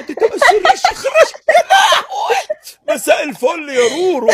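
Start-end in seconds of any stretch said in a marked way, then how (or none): 0.0-2.4 hammed-up wailing, high and rising; mock crying. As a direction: wail it like a fake sob. Mock comforting a crying caller.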